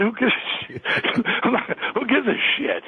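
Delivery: high-pitched, broken up by laughs; laughing dismissal. Retold or imitated. Rock star reassuring radio host he isn't mad, laughing. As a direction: shrug it off while laughing. Laughs through his retold reply.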